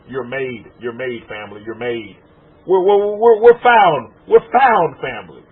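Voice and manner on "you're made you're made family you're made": low, soft mobster murmur; lightly voiced movie gangster welcoming you in; a hint of a mafia boss, softer; gangster movie quote. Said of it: "gangster movies where they say".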